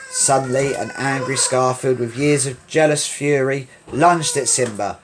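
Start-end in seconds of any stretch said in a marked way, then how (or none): none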